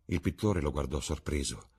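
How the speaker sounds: ordinary delivery, nothing marked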